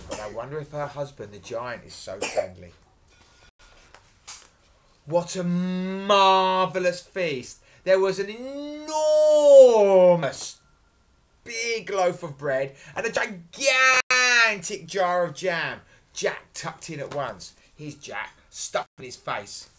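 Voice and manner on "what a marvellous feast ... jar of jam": loud, slow, relishing delivery; grand storytelling. A narrator's reading. Storyteller marvelling at the giantess's feast, grandly. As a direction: swell hugely on the size words.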